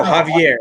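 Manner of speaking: Javier as "hah-vee-AIR"; Spanish-style "hah-vee-AIR" for Javier, falling; corrected pronunciation. Shown as a demonstration. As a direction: say Javier with an h sound, stressed on the end. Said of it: "That's how you say it".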